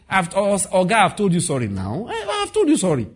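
rising, whining protest; mock self-pity, the pitch climbing high; whining excuse. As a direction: whine it like a defensive debtor. Mocking an entitled debtor.

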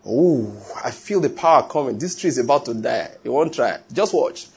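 hammed-up prayer warrior feeling the power; lightly mock a dramatic preacher; a hint of a theatrical healer.

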